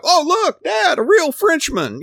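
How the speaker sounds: high, loud, drawn-out fake amazement; mock excited sightseer. Mocking a child pointing out a Frenchman to Dad, sarcastic excitement. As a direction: squeal it like an awestruck kid, sarcastically.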